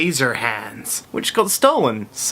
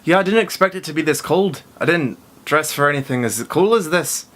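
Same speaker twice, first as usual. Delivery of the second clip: put-on failed Irish accent, slipping into Scottish; Irish accent attempt; 3 semitones higher than usual. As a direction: imitate an Irish accent that drifts Scottish, pitched up. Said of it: "that was a bit Scottish".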